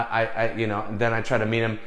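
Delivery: ordinary talk, nothing marked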